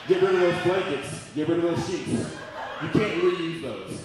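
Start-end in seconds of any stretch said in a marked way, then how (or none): none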